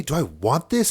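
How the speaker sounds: rising panic, loud and high; frantic, freaked-out questioning; panicked accusation. Retold or imitated. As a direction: blurt it out, losing it. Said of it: "I was losing it so much".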